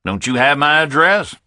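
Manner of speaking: put-on ribbing the phone company in a Southern accent; a Southern caller teases the phone company about his bill; jocular southern man. A narrator's reading. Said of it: "Man-to-man jocular stuff".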